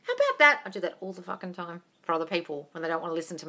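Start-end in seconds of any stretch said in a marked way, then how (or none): 0.0-0.6 high, feigned amazement; mock-surprised reply, pitch leaping high; mock surprise. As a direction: pretend to be surprised. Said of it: "And you go".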